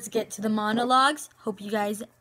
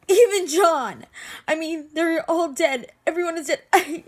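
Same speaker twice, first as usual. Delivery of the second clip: loud, raised voice listing losses; desperate, grief-stricken protest; anguished grief; 3 semitones higher, 6 dB louder than usual. Acted. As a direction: build in distress toward breaking down. Acted grief outburst listing the dead.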